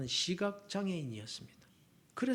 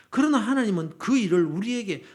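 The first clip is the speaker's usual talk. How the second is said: ordinary talk, nothing marked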